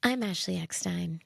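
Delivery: low, smooth radio host voice; playful low radio-host impression; Delilah. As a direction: drop the pitch into a late-night radio sign-off. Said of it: "I have to do my Delilah radio voice".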